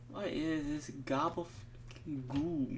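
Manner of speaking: ordinary talk, nothing marked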